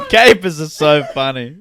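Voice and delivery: ordinary talk, nothing marked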